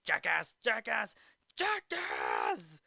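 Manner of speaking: high, imitated heckler's shout; taunting call, stretched on the last one; heckling. Imitating the heckler in the archive recordings, jeering shout. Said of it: "archive recordings of the jackass guy saying".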